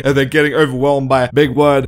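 normal talking voice

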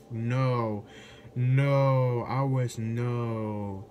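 ordinary talk, nothing marked

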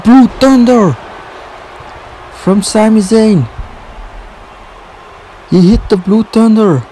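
high, thrilled play-by-play; excited wrestling call. Real, not acted. Big wrestling move just landed.

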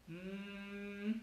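"mm" as a long, soft, high hum; sustained soft "mm" with closed lips. Shown as a demonstration. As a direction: hum the M and hold it. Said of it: "son larguísimas".

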